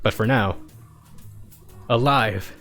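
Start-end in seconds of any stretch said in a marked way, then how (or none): none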